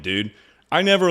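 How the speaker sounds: usual speaking voice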